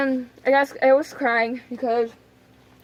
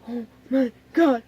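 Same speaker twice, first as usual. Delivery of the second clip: slow, whimpered retelling; mock dread. Retelling the tied arm to viewers, mock dread. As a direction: drag it out, word by word.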